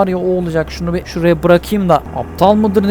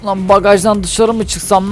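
ordinary talk, nothing marked

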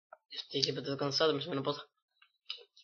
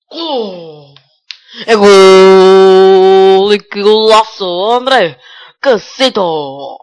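explosive goal commentary; 2 semitones higher, 16 dB louder, much slower than usual. Real, not acted. Celebrating a goal.

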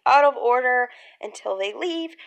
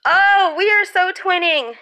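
high, loud, gushing delight; delighted exclamation; 6 semitones higher, 6 dB louder than usual. Real, not acted. Vlogger sees she and her friend match, delighted.

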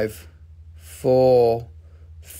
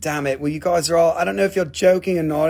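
ordinary talk

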